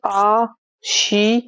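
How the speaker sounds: आ शी as two separate drawn-out syllables. Shown as a demonstration. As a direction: sound out आ, then शी. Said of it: "जिसे हम बोलते हैं".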